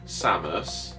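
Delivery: ordinary talk, nothing marked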